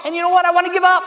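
high, loud burst of exasperation; frustrated outburst. Acted. Preacher on praying seventeen years for brother, frustrated outburst.

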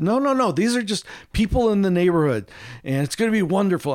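oversell it like an eager team captain; overly enthusiastic. Retold or imitated. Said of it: "a little too much enthusiasm".

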